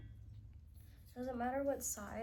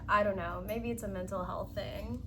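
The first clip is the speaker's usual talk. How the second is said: ordinary talk, nothing marked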